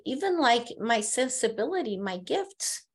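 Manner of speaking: ordinary talk, nothing marked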